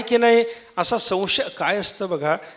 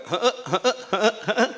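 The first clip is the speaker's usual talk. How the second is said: soft, panting mock donkey hn-hn after the bray; donkey huffing; 4 dB quieter, slower than usual. Preacher imitating the donkey's trailing grunts, soft. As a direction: huff on like a winded donkey. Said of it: "ओरडणं झाल्याच्या नंतर परत ते लगेच शांत होत नाही".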